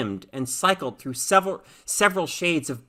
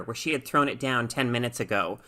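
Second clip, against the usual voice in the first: ordinary delivery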